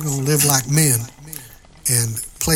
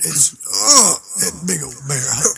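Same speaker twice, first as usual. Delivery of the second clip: choked-up cry; 2 semitones higher than usual. Acted. Emotional hug reunion.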